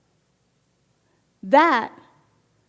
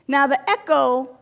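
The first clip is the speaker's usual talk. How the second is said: normal talking voice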